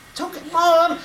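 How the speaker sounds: put-on boy telling his mother it's fine, high; little boy voice. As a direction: reassure mom like a small kid. Boy answers his mom.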